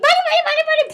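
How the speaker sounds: high, loud, frantic chant; mock shriek to hurry them; hurrying ajumma. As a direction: imitate an ajumma shoving people along. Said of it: "The ajumma, like, rushes us".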